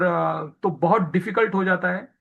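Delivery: ordinary delivery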